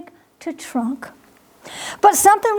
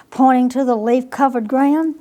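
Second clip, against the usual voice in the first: plain reading voice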